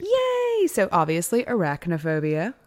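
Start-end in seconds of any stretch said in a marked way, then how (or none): 0.0-0.7 high squeaky yay; mock cheer. Real, not acted. Mock cheer before butchering names.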